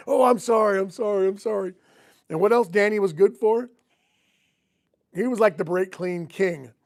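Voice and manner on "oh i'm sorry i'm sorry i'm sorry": repeated fake-innocent sorries; mock-contrite, playing it as an accident; feigned apology. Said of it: "acted like he didn't do it on purpose".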